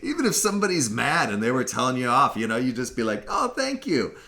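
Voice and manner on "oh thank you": put-on high, sing-songy African accent; lilting African-accent imitation, cheerful and laughing; happy African accent. Being told off in a friendly African accent, happy sing-song. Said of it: "really kind of sing-songy, happy kind of accent".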